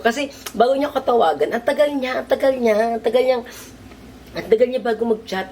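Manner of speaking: worked-up griping; exasperated. Real, not acted. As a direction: vent about how long he took. Complaining about his delay.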